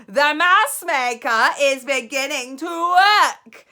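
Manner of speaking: high, very loud slow screech; triumphant witch screech. Acted. Grand High Witch screeching at the audience.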